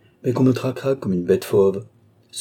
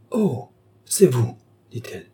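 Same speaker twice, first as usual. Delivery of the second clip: a taken-aback, higher-pitched cry; startled; 5 semitones higher than usual. A narrator's reading. Young woman discovering an intruder at the door, startled.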